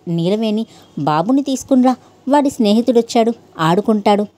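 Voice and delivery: usual speaking voice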